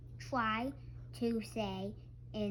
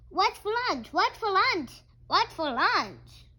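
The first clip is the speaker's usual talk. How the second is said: high, loud little duck voice; eager duckling; 8 semitones higher, 9 dB louder than usual. Acted. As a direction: demand it like a hungry duckling. Hungry duckling asking.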